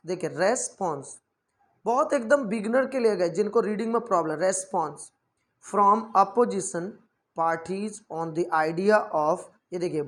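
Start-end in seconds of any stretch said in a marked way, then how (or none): 4.4-9.4 slow, careful word-by-word reading; teacher reading slowly for beginners. Shown as a demonstration. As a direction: read parties on the idea of slowly. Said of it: "अब फिर आप इसे fluency में read करिए".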